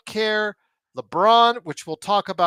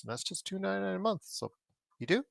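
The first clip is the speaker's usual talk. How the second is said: ordinary talk, nothing marked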